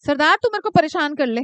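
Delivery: ordinary talk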